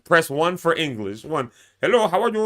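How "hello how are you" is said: light imitation of a call-centre rep with an Indian accent, a bit higher; a hint of an Indian accent on a polite phone greeting; mock Indian accent. Caller mimics the Indian customer service rep, light Indian accent. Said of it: "thick, heavy accent".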